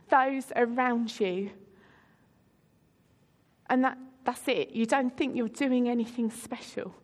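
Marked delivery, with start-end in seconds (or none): none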